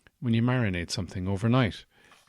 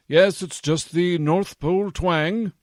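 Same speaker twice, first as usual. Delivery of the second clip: North Pole twang; 9 semitones higher, 7 dB louder than usual. Acted. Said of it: "you've got a little bit of an accent".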